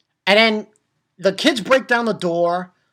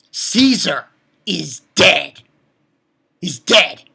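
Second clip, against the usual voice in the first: louder, slow, each word weighed; slow declaration; 7 dB louder, slower than usual. Retold or imitated. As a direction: pronounce the villain's doom slowly. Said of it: "And I'm like".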